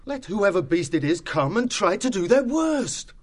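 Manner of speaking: rally the others for a last fight; resolute. A narrator's reading.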